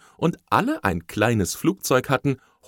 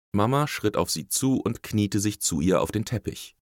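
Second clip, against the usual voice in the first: ordinary delivery, nothing marked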